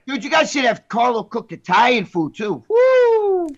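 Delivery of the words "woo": a high, loud whoop of excitement; loud woo cheer. Real, not acted. Cheering about Italian food.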